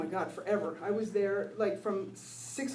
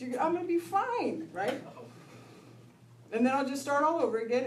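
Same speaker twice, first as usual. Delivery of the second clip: normal talking voice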